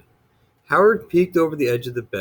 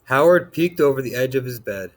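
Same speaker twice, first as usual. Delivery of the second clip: ordinary delivery, nothing marked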